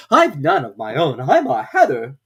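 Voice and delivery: a hatter explaining himself; lightly voiced Hatter. A narrator's reading. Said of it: "as an explanation".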